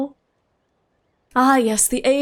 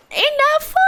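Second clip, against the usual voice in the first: squeaky laugh. Real, not acted.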